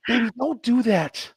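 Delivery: gently voiced wife, slowly; wife pleading. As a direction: ease him off like his wife would. Wife calming him down.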